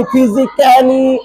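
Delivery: "फिजिकली" slowed, with "ai" sounded after the second k; wrong pronunciation. Shown as a demonstration. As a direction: stretch "फिजिकली" into fizik-kailee. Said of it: "ऐसे ऐ नहीं आएगा". ("फिजिकली" को फिजिक-कैली जैसा खींचकर)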